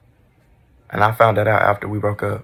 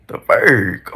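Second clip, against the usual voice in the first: ordinary talk, nothing marked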